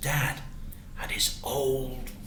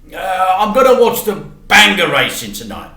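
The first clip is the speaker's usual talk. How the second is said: dad; 8 semitones higher, 12 dB louder than usual. Acted. Dad explains going out.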